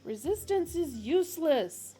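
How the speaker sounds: loud, stiff guard's bellow. Acted. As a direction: bellow it like a brute. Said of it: "bellowed the guard".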